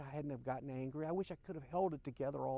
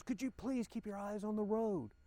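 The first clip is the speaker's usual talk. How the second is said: higher-pitched pleading complaint; imitating a pained outcry, only mildly raised; retold cry; 6 semitones higher than usual. Wife jolted by a bump scolding the driver, retold mild cry. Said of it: "And she cried out".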